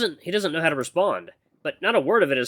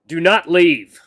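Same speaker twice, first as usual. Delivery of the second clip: louder, urgent command; sudden outburst; 4 semitones higher, 10 dB louder, much slower than usual. Acted. Mentor begging his acolyte to stay, urgent outburst. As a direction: burst out with a stiff, urgent order. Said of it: "taken aback by his outburst".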